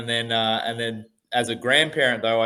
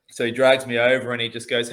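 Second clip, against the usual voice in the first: ordinary delivery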